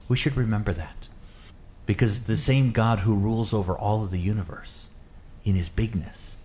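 usual speaking voice

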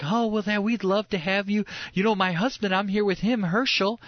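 high-pitched imitated Southern woman; Southern accent. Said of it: "kind of a Southern accent".

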